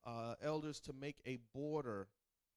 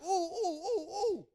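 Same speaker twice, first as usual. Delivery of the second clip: put-on high, loud childish squeals of delight; a kid thrilled about ice cream; excited kid squeals; 14 semitones higher, 8 dB louder than usual. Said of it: "And they're like".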